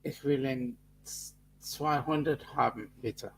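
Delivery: put-on German ordering voice; German accent. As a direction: place an order in playful German. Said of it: "when you do your German accent".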